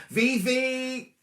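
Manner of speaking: high-pitched, drawn-out call of a name; excited greeting. Real, not acted. Host spots the former nanny watching, excited high call. Delighted greeting to viewer Vivi.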